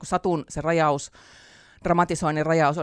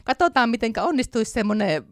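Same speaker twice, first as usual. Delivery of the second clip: lightly chuckly, higher-pitched musing; amused self-mockery; 7 semitones higher than usual. Writer retelling how she dared the theatre with an impossible scene, amused self-mockery. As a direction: toy with a silly idea in a raised voice. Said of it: "niin mä sille naureskelin".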